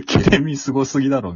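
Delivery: 高く大きな声で驚いてツッコむ; 大げさに感心してツッコむ; おどけたツッコミ. Retold or imitated. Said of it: "みたいな". (高めの大声で大げさに驚く)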